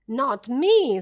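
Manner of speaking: loud panicked refusal; scream. A narrator's reading. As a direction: scream it, backing away. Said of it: "screamed Buns".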